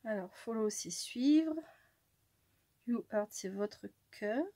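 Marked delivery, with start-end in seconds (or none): none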